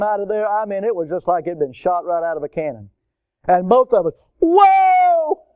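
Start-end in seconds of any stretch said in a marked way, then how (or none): 4.4-5.5 long, high, loud mock-terrified holler; drawn-out whoop of alarm, acted out; startled yell. Two people seeing the snake leap out, high startled holler.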